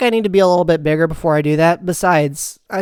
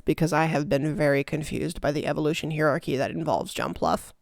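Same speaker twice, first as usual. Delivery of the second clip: softer, lower mock-confused repeat; quieter repeat; 4 semitones lower, 9 dB quieter than usual. Real, not acted. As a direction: drop it low and deadpan. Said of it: "repeated line".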